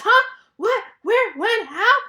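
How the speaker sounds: high, loud burst of startled questions. Acted. Gerald wakes from his nap asking questions, loud and startled. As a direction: fire out each word as a separate loud, high yelp. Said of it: "he asks them so loudly".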